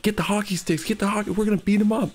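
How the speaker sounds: rally the group to attack, hammed-up; mock threatening shout. Partygoer telling friends to grab hockey sticks, mock threatening shout.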